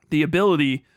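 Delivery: ordinary talk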